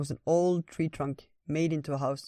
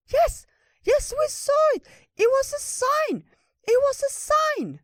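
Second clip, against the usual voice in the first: very high, loud squeaky cheering; excited little critter; 7 dB louder, slower than usual. Acted. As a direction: interrupt in a thrilled, piercingly high squeak.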